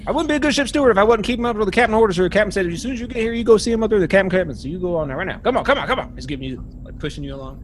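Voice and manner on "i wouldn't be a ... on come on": put-on a pushy cook calling the young man over; Buzz the steward.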